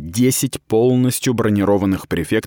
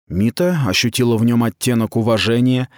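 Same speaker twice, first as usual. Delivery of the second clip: ordinary delivery, nothing marked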